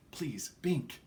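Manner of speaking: whispered plea; breathy urgent whisper. A narrator's reading. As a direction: hush him, pulling him back. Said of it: "Sabrina urged".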